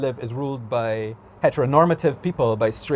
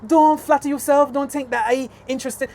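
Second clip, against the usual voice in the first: high-pitched, indignant brush-off; pointed, high-pitched rebuff; exasperated retort; 8 semitones higher than usual. Retold or imitated. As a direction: set them straight in a raised pitch. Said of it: "I just be like".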